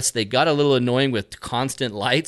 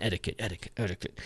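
much softer, low muttering of the word; muttered cussing; 6 semitones lower, 13 dB quieter, faster than usual. Retold or imitated. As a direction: mutter it while walking away. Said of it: "he just kept kinda cussing".

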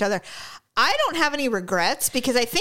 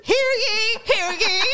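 town crier; 10 semitones higher, slower than usual. Pretend. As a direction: call out like a herald. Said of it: "here's my other personality".

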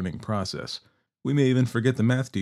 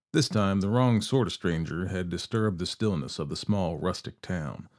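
usual speaking voice

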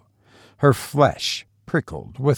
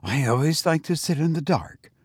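higher, slightly choked reply; choking; 5 semitones higher, 3 dB quieter than usual. A narrator's reading. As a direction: answer as if mildly choked up. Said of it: "replied Rebecca chokingly".

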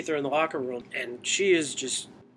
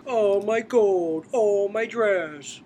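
very slow, high, loud mock lament; fake crying; 5 semitones higher, 5 dB louder, much slower than usual. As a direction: whine it like a spoiled kid. Mocking characters' laments.

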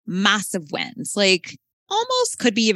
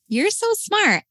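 high, sing-song praise; praising mom; 14 semitones higher than usual. Acted. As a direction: beam at the child as you say it. Mom praising her child.

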